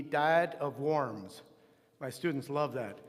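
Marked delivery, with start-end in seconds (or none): 0.0-1.4 "Diet of Worms" read slowly as English, "die-et" with a W; English spelling pronunciation. Shown as a demonstration. Teacher reading out the spelling his students love, English spelling pronunciation. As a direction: say "Diet of Worms" like eating earthworms, drawn out. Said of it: "it's spelled D I E T".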